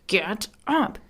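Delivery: drawn-out, mildly annoyed order; emphatic command. Acted. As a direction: make it a firm statement. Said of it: "makes it more of a statement".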